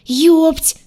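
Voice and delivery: high, loud yelp; startled yell. A narrator's reading. Heroine and crocodile startle each other, loud yell. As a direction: yell it out in shock. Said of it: "заорали мы синхронно".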